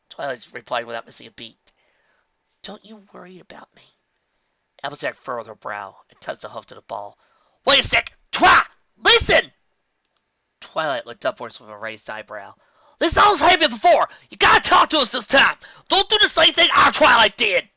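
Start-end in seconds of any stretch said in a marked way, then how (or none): 2.6-4.0 soft reassurance. A narrator's reading. Twilight deflects softly. 7.6-9.5 urgent shout. A narrator's reading. Applejack urges loudly. 13.0-17.7 loud pleading. A narrator's reading. Pleading loudly through door.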